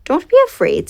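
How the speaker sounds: quickly calm the cub; reassuring call. Acted. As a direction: hippo soothing the startled bear.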